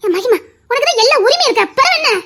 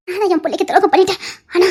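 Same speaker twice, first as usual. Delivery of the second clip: ordinary talk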